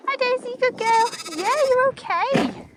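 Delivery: high-pitched reassuring dog talk; cooing to the dog. Real, not acted. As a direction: soothe the dog up high like talking to a pet.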